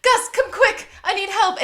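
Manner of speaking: high, loud frantic calling out; hammed-up panicked summons; mock urgent call. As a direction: call Gus over like it's an emergency. [name] calling Gus in urgently.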